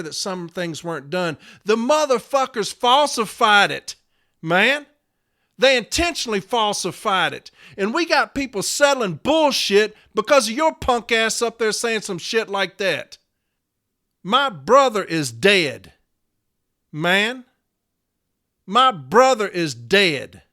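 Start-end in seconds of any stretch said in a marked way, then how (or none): 1.6-13.2 raised, forceful indignation; angry accusation. Real, not acted. Member accusing bosses of falsifying training records, angry. 14.2-20.4 slow, emphatic outrage; anguished anger. Real, not acted. Member to union leader about his dead brother, anguished anger.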